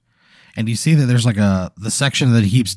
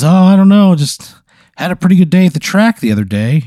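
much higher, louder, friendly creature voice; cheery snooze monster; 9 semitones higher, 6 dB louder than usual. Acted. Snooze monster greeting Pat with a high, chummy voice. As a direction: greet him like an old buddy, high and bright.

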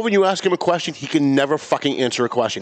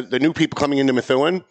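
ordinary talk, nothing marked

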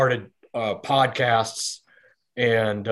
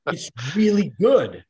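higher, swooping retold praise; imitating wife's enthusiasm; 5 semitones higher, 3 dB louder than usual. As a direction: mimic an enthusiastic recommendation, pitch swooping up. Wife's praise quoted in retold exchange.

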